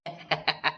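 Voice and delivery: short chuckle; amused laugh without words. Acted. Boys joking while bathing in river.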